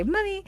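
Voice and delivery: "mummy" as said in the UK; British mummy. Retold or imitated. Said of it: "in the U K, they would say".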